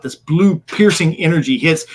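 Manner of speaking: normal talking voice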